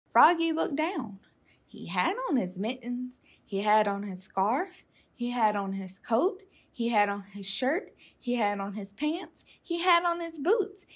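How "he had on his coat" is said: plain reading voice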